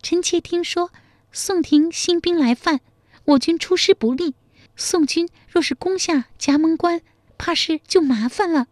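high-pitched, even lady's voice; high feminine character voice; worried royal concubine. A narrator's reading. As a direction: speak high as the emperor's consort.

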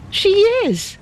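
sharp, very high demand; fierce question. A narrator's reading. Ada demands of Lin Fo, fierce and high. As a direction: snap it back in outrage.

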